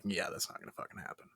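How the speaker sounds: low, quick, half-whispered dismissal; muttered aside. Retold or imitated. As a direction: mutter it under your breath. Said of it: "I would have been like".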